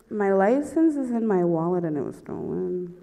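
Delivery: halting confession. Retold or imitated. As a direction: spell it out haltingly for the officer. First answer to the cop.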